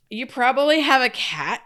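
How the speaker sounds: put-on high, loud snide mean girl. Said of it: "you kinda read it like a mean girl".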